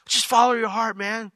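high, breezy parody of a cliché; mock the easy advice; mocking quote. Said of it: "you hear people say it".

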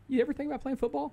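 teacher's question pitched well up, quick; imagined teacher. Pretend. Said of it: "some teacher says".

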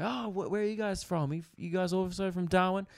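put-on high, curious old-couple voice; a chirpy nosy question; imitating old neighbours. Old neighbour asking where they are from, high and chirpy. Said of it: "They go".

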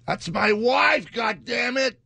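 high, slow, loud American movie-guy shout; American accent impression. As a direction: belt it like an outraged American film husband. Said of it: "I'm doing American".

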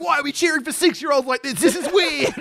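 high-pitched protest; retold baffled outburst; incredulous. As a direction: question it in high disbelief. Retold baffled reaction to cheering.

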